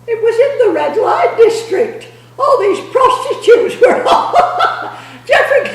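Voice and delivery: can barely get the words out for laughing. Real, not acted. Telling a friend about the red light district, real laughter.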